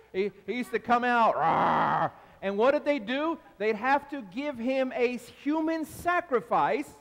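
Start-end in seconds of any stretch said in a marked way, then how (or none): 1.4-2.1 low, loud mock ape roar; hammed-up movie monster roar; King Kong roar.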